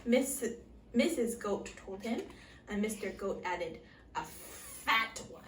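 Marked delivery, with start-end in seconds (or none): 4.2-5.4 soft unvoiced whisper; hushed secret. A narrator's reading. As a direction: lean in and whisper it. Whispered aside.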